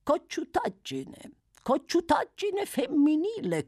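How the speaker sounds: very high, slow character drawl. A narrator's reading. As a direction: stretch the words out in a high, knowing singsong.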